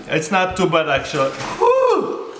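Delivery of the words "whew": sharp high-pitched whew; high sour whew. Real, not acted. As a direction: blow out the sourness. Sour lemon reaction.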